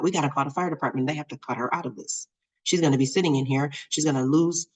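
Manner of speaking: fast, run-on worrying; spiraling worry. Retold or imitated. Mom imagining waiting for the fire department, spiraling. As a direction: rattle off worst cases as if spiraling. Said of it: "And I, I am spiraling".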